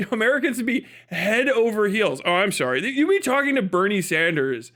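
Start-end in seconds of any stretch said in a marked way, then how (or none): none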